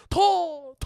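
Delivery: imitating game fighters' punch cries; silly fighting yells. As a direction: yelp like cartoon boxers landing punches. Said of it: "they were like".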